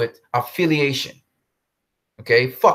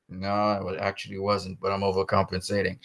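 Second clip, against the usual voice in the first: much softer, lower confession; inner voice; 8 semitones lower, 9 dB quieter than usual. Retold or imitated. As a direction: murmur it as a secret thought. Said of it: "deep inside, I'm like".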